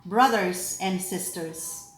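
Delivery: measured, solemn proclamation with deliberate pauses; lector reading scripture. Acted. Lector proclaiming the readings to the assembly, solemn.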